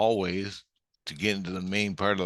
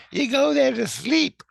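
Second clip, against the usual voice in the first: very high, loud put-on voice; Tina; 7 dB louder than usual. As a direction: echo it in a piercingly high mimicking voice. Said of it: "Tina goes".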